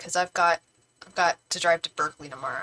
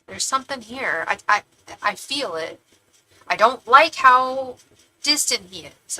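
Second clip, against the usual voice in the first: ordinary talk, nothing marked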